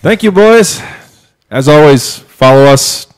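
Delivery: loud bright outro voice; show host sign-off. Real, not acted.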